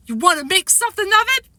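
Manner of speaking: high-pitched kid acting tough; tough little boy. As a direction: act a little boy picking a fight. Small boy's challenge voiced.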